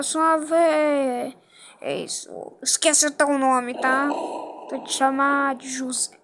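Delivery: usual speaking voice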